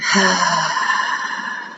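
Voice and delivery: long audible open-mouth sigh out; demonstrated sigh. Shown as a demonstration. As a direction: let the breath fall out in a sigh. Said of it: "you can even sigh".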